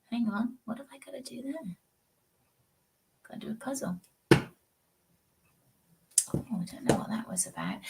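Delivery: whispering to herself off-mic; under her breath, working something out; whispered muttering mid-stream; whispered aside. Real, not acted. Whispered aside while doing a puzzle.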